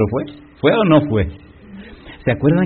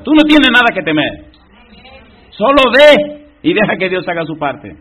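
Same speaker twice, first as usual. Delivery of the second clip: loud, driving sermon voice, lifting higher; preaching at full force; 8 semitones higher, 6 dB louder than usual. Acted. Pastor assures the congregation God's mission cannot fail, preaching hard. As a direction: hammer home that success is certain.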